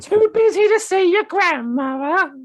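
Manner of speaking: put-on very high, slow old woman's guilt trip; grandma Alexa shaming her grandchild, high and slow; nagging grandmother. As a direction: draw it out in a shrill granny voice. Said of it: "Alexa's gonna be like".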